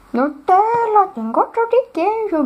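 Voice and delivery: high mock joking voice.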